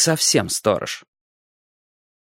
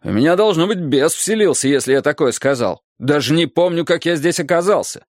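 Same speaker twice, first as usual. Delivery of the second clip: higher, defensive bewilderment; protest, at a loss to explain; baffled protest; 4 semitones higher than usual. A narrator's reading. Director defending himself to the teacher, baffled. Директор оправдывается перед учительницей.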